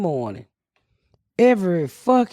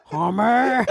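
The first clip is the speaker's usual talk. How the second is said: calling Homer, Marge-style. Retold or imitated. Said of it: "She d-, does the upper lip thing".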